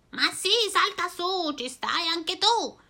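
voce più acuta, invitante; strega allegra. Acted. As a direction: accogli l'amico con slancio. La strega risponde. (strega allegra, voce acuta)

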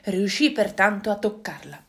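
ordinary delivery

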